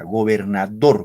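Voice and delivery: gobernador, el golpe en "dor", lento y fuerte; stress on dor. Shown as a demonstration. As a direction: alarga y marca "dor" en gobernador. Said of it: "el acento recae acá, en dor". (acento marcado en "dor" de gobernador)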